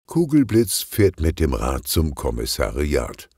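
ordinary delivery

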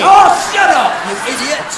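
high, loud, exasperated cry; laughing shout. Real, not acted. Comic laughing at his partner, shouting him down. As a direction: yell it through a laugh.

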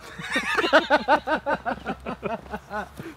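laughing fit. Real, not acted. Breaks up during intro take.

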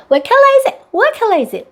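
put-on high, lively question to a child; bright mom question. Shown as a demonstration. Mom quizzing her child, high and lively. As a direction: ask it like an eager mom. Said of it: "what color is it은 엄마가 해주세요".

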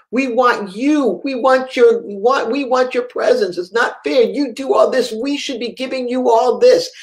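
high, urgent pleading, imitating a boy; retold pleading prayer. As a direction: beg God insistently, as the child did. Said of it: "That was a love language".

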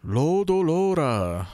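"Lodo lora" high, slow, o's held; long vowels. Shown as a demonstration. Replaying the road roller cry for fun, high elongated "Lodo lora". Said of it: "it would be long vowel".

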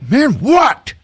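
high hammed-up holler; irritated shout back. Gatherer answering Reggie, high shouted reply. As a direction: yell back at the caller.

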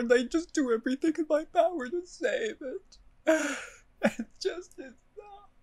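high, tearful despair; crying out in despair; voice breaking. Real, not acted.

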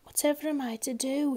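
a touch of despair; mild despair. A narrator's reading. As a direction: fret helplessly to yourself. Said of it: "with a feeling of despair".

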